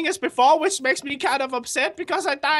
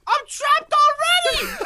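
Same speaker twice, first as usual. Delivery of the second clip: panicked cry; 10 semitones higher, 3 dB louder, slower than usual. Real, not acted. Trapped in lava again.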